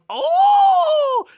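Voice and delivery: very high, loud squealed oh; an amazed little robot cry; robots cheering oh. Retold or imitated. Retelling chibi robots cheering the couple's kicks, squealed oh. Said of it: "like, going".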